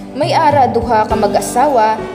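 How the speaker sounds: usual speaking voice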